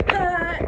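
loud, high "ha"; demonstrated laugh. Shown as a demonstration. Vlogger showing the fun people she wants, loud mock laugh. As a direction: burst out one big mock laugh.

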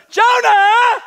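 very high, loud sailor's shout. Acted. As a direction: scream his name across the deck. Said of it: "they demanded".

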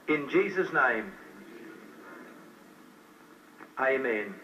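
slow, earnest prayer with long pauses; slow led prayer. Acted. Preacher leading new converts in prayer, slow and earnest. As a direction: lead the prayer phrase by phrase for others to repeat.

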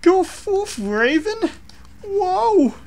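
very high squeaky cartoon voice; cartoon mask voice. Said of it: "Crush is like".

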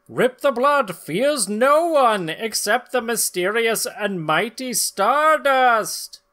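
high, loud pulp-comic narrator; dramatic comic narration. Acted. Narrator introducing the villain, high and dramatic. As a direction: announce it like a breathless old serial.

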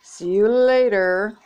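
ordinary delivery

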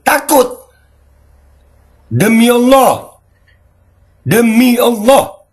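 high, loud, slow quavering oath; mock terror. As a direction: ham up the fear, drawing out each word. Dramatic fearful oath. (sumpah ketakutan pura-pura)

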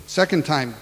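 normal talking voice

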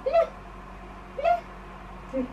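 tiny, very high squeak; short little mouse peep; squeaky mouse. Shown as a demonstration. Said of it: "Like a tiny squeaky mouse".

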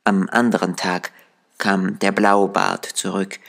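ordinary delivery, nothing marked

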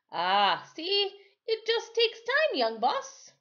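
high, slow reassuring island voice; Timothy voiced. Timothy soothes the injured boy, high and slow. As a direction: gently soothe the hurt boy.

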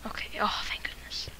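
fast relieved whisper. Real, not acted. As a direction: breathe out the relief. Relief after escape.

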